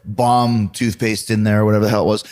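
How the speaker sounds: ordinary talk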